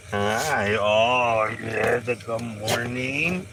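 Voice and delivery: stretched-out loving pet greeting; doting dog talk. Real, not acted.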